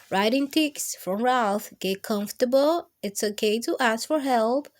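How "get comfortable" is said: plain reading voice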